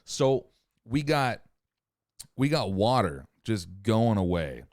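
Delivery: ordinary talk, nothing marked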